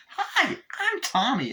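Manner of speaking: very high, slow talking-doll voice; Tommy. Retold or imitated. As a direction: chirp a drawn-out toy greeting. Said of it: "And then Chucky's like".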